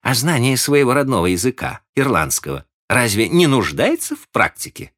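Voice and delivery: a hint of a needling young woman; pointed question. A narrator's reading. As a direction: needle him about Irish. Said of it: "спросила мисс Айверс".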